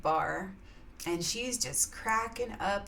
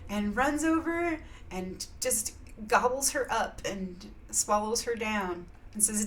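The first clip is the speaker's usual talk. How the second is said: normal talking voice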